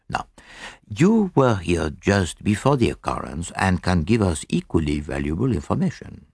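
put-on lightly accented, courteous detective; measured, tactful sleuth making a polite request; Poirot. Poirot questions the captain politely.

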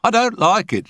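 high-pitched, uncomfortable complaint; high medium voice, ill at ease; uneasy medium. A narrator's reading. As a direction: pitch it high, voicing your misgivings. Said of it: "shrugged them uncomfortably".

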